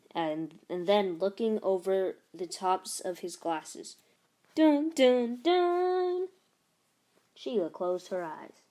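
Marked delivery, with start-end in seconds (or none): 4.6-6.3 rising mock-ominous "dun, dun, dun"; dramatic sting. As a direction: hum a spooky movie sting, climbing high. Zeke dramatic over his glasses.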